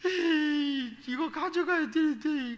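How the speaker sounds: put-on high, slow whiny little boy voice; whining child. Boy whining to his father for school fees, slow high whine. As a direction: sulk and drag it out like a kid.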